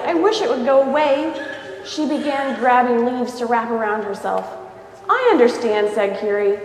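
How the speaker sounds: lively read-aloud storybook voice; story narration. A narrator's reading. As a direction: read it to a child, voicing each character. Reads picture book aloud.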